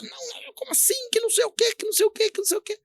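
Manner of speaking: high, soft mimicry of an angry cashier; mock indignant interruption. Cashier refusing to let the customer explain, mock indignant. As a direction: babble her objections in a small squeaky voice. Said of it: "não deixou eu me expressar".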